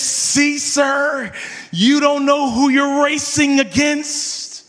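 slow, smug gloating at the loser; cocky taunt. Retold or imitated. Teen driver gloating at the car he beat, smug and slow. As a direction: rub it in, drawn out and proud.

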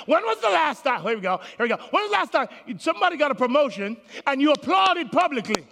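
ordinary talk

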